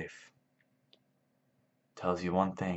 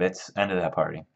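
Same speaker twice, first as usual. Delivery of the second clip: ordinary delivery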